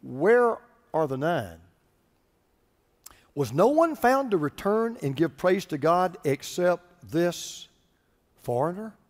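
low, unhurried reading of Jesus's questions; measured scripture question. Acted. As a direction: read gravely, pausing before the last words. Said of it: "Then Jesus answered".

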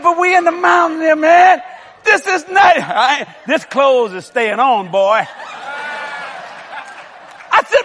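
high, loud, laughing plea to his wife; begging his wife in the tent, high and pleading; mock pleading. Husband begging wife in cold tent, high loud pleading.

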